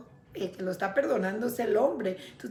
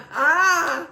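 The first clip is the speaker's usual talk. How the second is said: breathy laugh breaking into a loud high ah; real laugh; 7 semitones higher, 7 dB louder than usual. Real, not acted. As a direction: laugh at yourself, then burst out ah. Preacher loses thread, laughs.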